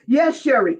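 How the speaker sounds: ordinary delivery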